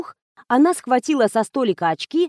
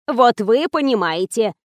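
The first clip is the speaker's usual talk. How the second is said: ordinary delivery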